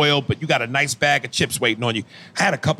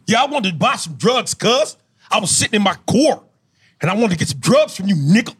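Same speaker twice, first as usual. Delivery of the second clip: put-on higher-pitched LA gangbanger accent with punched word endings; California gang member accent; 5 semitones higher than usual. Gang member asking a mobster for drugs, thick LA accent. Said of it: "can you improv a California gang member".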